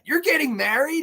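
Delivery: high incredulous question; can't believe what he's hearing; shocked disbelief. Retold or imitated. Robin shocked, retold.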